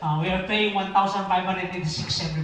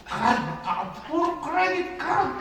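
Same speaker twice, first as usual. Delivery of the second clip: laughing; 10 semitones higher, slower than usual. Real, not acted. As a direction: laugh in disbelief at the debt. Laughing at own debt.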